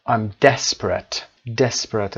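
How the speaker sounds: desperate with a strong first syllable DES; stress on DES. Shown as a demonstration. Teacher modelling an adjective, stress on DES in desperate. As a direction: lean on DES in desperate, slowly. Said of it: "استرس روی ... دس هست".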